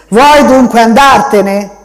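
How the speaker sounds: loud, slow threatening question; menacing. A narrator's reading. The man to the boy, loud slow threat.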